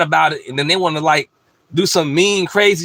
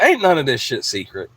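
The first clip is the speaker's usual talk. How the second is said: ordinary talk, nothing marked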